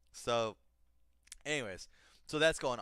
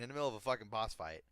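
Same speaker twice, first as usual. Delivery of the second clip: ordinary talk, nothing marked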